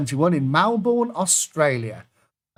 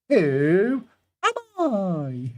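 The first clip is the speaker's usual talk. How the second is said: slow teasing question; 4 semitones higher, much slower than usual. Pretend. Dramatic quiz question ending clues.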